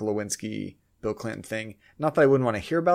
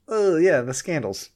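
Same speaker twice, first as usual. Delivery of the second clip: normal talking voice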